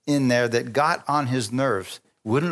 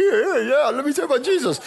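high, mock-eager chatter; a high, hammed-up eager believer; eager young convert; 11 semitones higher than usual. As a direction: play your naive, overeager self. Mocks his young eager self.

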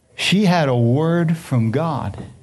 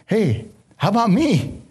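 imitating Esau; 5 semitones higher than usual. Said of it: "Esau might have said".

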